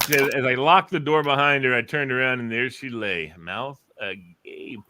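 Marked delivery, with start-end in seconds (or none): 1.8-4.8 slow, drawn-out mouth agape; hint of mock-dramatic slow reveal; slow dramatic telling. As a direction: linger on the last words like a seedy storyteller. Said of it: "retelling the radio bit again".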